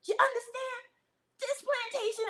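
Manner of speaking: high, taunting plantation boss voice; hammed-up overseer mocking a commenter.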